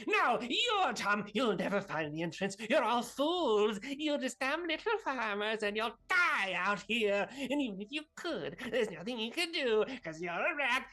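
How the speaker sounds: high, loud jeering villain; taunting Zippotra. Acted. Game master plays taunting Zippotra.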